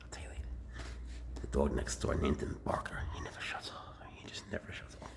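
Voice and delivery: gossiping whisper; hushed gossip. Real, not acted. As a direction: whisper the gossip behind a hand. Whispering gossip about neighbour dog.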